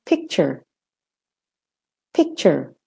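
"picture" with an American r-coloured ending; short American er ending "picture". Shown as a demonstration. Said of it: "and American".

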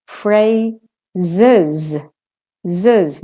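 "Phrases" split slowly, fray... zuz; two Z's. Shown as a demonstration. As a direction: break "Phrases" in syllables, buzzing both z's. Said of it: "there are two Z's there".

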